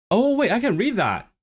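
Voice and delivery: high-pitched delighted discovery; oh-wait moment, pitch jumping up; sudden realisation. Real, not acted. Streamer spots text he can read, surprised.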